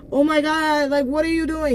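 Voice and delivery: high-pitched imitation of someone caught; startled panic. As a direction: act flustered at being walked in on.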